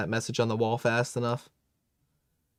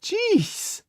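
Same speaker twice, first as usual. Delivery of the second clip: shocked squeak; 3 dB louder than usual. Real, not acted. Reacting to a shocking scene.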